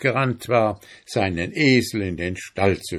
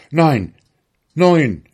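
loud, higher bargaining cry; shouting; 5 semitones higher, 7 dB louder than usual. A narrator's reading. Miller haggling with the donkey owner, shouting. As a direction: cry out a firm counter-offer. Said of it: "schrie der Müller".

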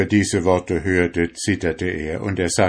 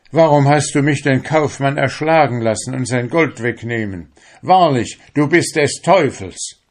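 higher, louder reproach; angry accusation; 6 semitones higher, 6 dB louder than usual. A narrator's reading. Fisherman blames his soul for the crime, angry accusation. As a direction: denounce them with rising outrage.

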